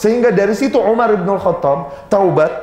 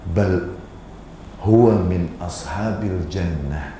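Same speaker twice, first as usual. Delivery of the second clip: reverent and gentle; 14 semitones lower, 7 dB quieter, slower than usual. Retold or imitated. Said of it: "Rasul berkata apa?".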